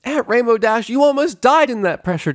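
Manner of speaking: high, loud scolding shout. Acted. Pepper scolds Rainbow, high loud shout. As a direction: yell at her for being reckless. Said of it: "Pepper shouted".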